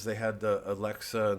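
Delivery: normal talking voice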